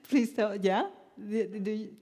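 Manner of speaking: laughing through words. Real, not acted. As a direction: speak while laughing. Laughing at being cut off.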